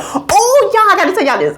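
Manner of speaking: high, eager announcement; bubbling over; excited burst. Real, not acted. Bursting with news.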